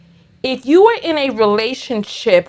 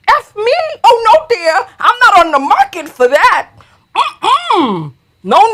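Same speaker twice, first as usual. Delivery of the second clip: high, loud, scandalized clapback; sassy indignation; 9 semitones higher, 5 dB louder than usual. Real, not acted. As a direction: turn down the insult with loud, high-pitched sass. Mock indignant retort to an insult.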